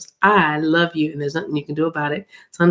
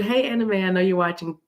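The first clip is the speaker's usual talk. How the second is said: usual speaking voice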